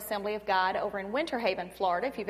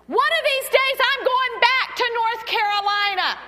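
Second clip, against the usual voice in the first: high, loud mock proclamation; boyfriend's bold vow; 10 semitones higher, 7 dB louder than usual. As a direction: announce a grand vow, imitating a boyfriend. Retells boyfriend's vow to return.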